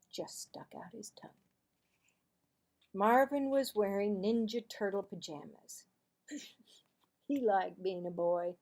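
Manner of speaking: plain reading voice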